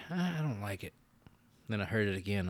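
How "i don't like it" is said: higher, softer reluctant dislike; retold first reaction. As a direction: recoil mildly, "don't like it". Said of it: "I was like".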